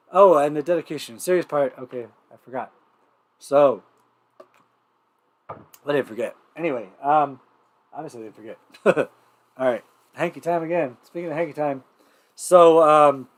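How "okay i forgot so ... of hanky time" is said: normal talking voice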